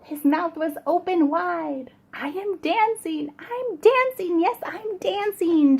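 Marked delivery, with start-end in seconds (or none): 2.1-5.8 much higher, gleeful squeal of delight; joyful cry. Acted. Gerald discovering he can dance, high joyful cry. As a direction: burst out with joy. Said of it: "Gerald cried".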